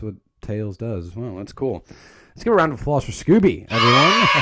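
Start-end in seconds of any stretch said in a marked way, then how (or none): 2.3-4.3 loud, higher MC-style call; playful shout to an audience; mock applause call. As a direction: whip up a crowd for applause.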